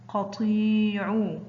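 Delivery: قطيع very slowly, طي held long; drawn-out example word. Shown as a demonstration. Teacher showing pupils the long vowel, slow and stretched.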